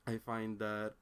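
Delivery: normal talking voice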